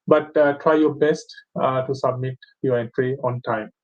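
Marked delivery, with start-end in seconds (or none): none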